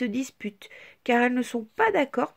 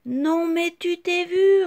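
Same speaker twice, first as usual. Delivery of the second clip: higher, louder, slow scornful jeer; mocking bully; 8 semitones higher, 7 dB louder than usual. A narrator's reading. Petit Carré jeers at Petite Tache, scornful and loud. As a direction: sneer it down at her. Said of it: "répond Petit Carré".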